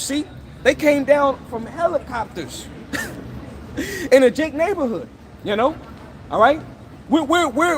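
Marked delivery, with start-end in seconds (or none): 2.9-4.0 a quick, high, soft mocking laugh; high scornful laugh. Real, not acted. Preacher scoffing at the helicopter raid, short high laugh.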